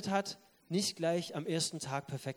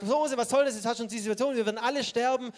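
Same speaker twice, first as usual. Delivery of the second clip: mock complaint of grumbling crowd, higher and louder; grumbling people; 6 semitones higher, 6 dB louder, faster than usual. The grumbling people to Moses, mock complaining. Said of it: "Das Volk, das jetzt kommt und murrt und sagt".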